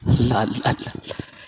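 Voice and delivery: God's name breathed, fading to a whisper; soft, fading devout murmur; awed whisper. Real, not acted. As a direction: sink into a hushed, reverent whisper.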